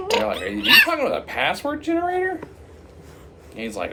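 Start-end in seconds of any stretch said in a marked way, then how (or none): 0.4-2.4 high, quick puzzled query; mock disbelief, pitched way up; incredulous question. Clerks checking what the customer means, incredulous question.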